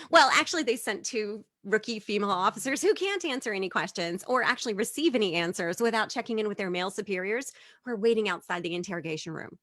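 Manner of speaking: ordinary delivery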